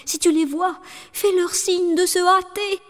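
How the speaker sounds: frightened wife begging her sister; wife calling her sister. A narrator's reading. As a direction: plead urgently with Anne. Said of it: "elle appela sa sœur aînée".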